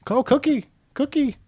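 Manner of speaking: high, loud call of Cookie. Pretend. Calling a name out high.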